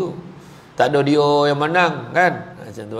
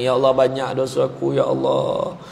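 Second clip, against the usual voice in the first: mock weeping prayer; 6 semitones lower than usual. Shown as a demonstration.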